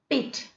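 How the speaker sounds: "pit" with a brief vowel; short E. Shown as a demonstration. Teacher giving an example word, short E. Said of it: "I'm not prolonging".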